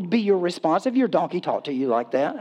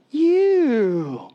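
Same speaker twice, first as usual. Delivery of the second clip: long, high-pitched exclamation of revulsion; mock disgust; 7 semitones higher, 3 dB louder than usual. Preacher reacting to what comes out of a man, mock disgust. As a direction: draw it out high, comically grossed out.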